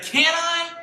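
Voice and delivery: put-on high, eager little-kid question. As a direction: een hint van een blij kind. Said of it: "He's like".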